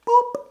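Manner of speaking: high squeaky boop; playful boop. Real, not acted. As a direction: chirp a high boop sound. Mimics switch sound.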